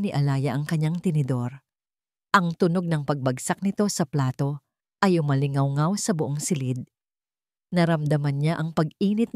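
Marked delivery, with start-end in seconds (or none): none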